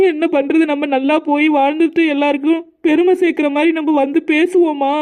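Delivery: high, tearful pleading. Acted. Bride tells her husband leaving her mother hurts, tearful. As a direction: voice lifted and close to tears. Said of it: "அழுவாதம்மா, அழுவாத".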